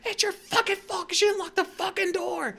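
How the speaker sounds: very high, strained acted screaming; retold furious yell. Blaming someone for the unlocked car door, retold furious yell. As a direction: scream the blame at them.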